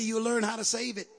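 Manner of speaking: normal talking voice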